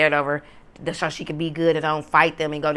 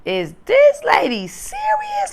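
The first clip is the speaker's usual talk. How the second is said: much higher, slow, stunned disbelief; 4 dB louder, much slower than usual. Retold or imitated. Reacting to the neighbor's yelling, slow high disbelief. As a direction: stretch it out like you can't believe it.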